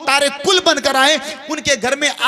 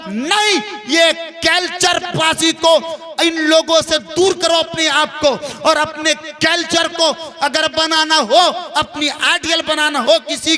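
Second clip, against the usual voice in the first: raised, insistent preacher's exhortation; emphatic rebuke; 4 semitones higher than usual. Real, not acted. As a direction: cry out the refusal from the pulpit, pitched high. Rebukes film fandom, urges turning away.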